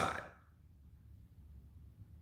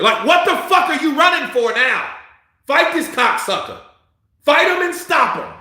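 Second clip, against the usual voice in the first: yelling at the fighter; 8 semitones higher than usual. Real, not acted. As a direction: bark it at the TV like a fan.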